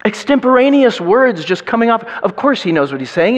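normal talking voice

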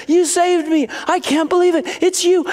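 put-on high, excited child's cry; overjoyed little kid. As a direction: act out a kid thrilled to see his hero. Kid gushing at Jesus in dream.

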